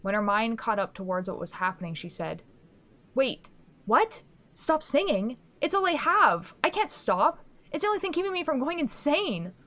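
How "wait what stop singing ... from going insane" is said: high, loud and pleading; desperate protest. Acted. Girl begging the figure to let her keep singing, desperate and loud. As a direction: fight back in rising panic.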